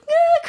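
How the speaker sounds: high mock wail of a crying kid; child's high mock cry.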